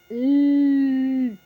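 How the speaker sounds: a loud held L, tongue on the palate; the bare L sound sung out for children; L sound demo. Shown as a demonstration. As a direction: sound out "L" loudly and hold it. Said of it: "Ele encosta no céu da boca".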